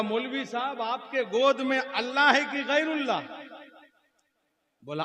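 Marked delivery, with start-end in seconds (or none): 0.0-3.2 loud, high pointed question; accusing challenge. Acted. Sunni runs up to cleric holding son, challenging. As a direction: corner him with a raised-voice question.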